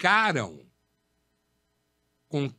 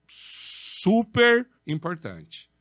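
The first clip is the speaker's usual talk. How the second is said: super importante drawn out very slowly and louder; stress; 3 semitones higher, 4 dB louder, much slower than usual. Shown as a demonstration. Teacher stressing emotional intelligence, slow and heavy. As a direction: stretch every syllable for weight. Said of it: "stressing a key point".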